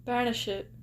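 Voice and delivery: ordinary talk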